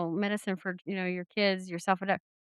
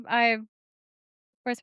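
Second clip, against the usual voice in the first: ordinary delivery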